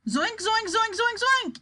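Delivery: high rapid-fire barked syllables; fast, sharp bark. A narrator's reading. Wonka barking like a machine gun, high and sharp. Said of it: "he barked".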